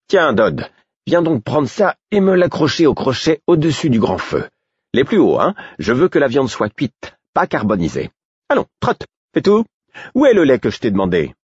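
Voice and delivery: put-on a high, busy cook shooing the boy off; bossy cook. Cook gives orders in kitchen.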